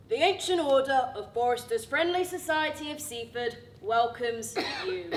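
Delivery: formal reading. Acted. Diary-like formal announcement.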